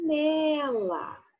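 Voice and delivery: Panela stretched slowly; teacher sounding out Panela; slow word demo. Shown as a demonstration. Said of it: "Usem espelhinho".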